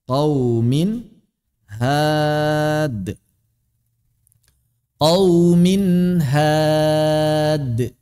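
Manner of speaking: slow chanted "qaumin had" with a pure, clear n; izhar jelas. Shown as a demonstration. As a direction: hold the vowels, sound the n of "qaumin" fully before "had". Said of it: "murni sebagaimana saat". ("qaumin had" dilantunkan pelan, nun murni dan jelas)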